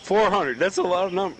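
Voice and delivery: astonished. Real, not acted. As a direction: blurt it out in shock. Reacting to an absurd number.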